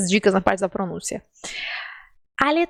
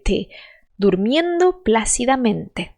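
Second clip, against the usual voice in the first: ordinary talk, nothing marked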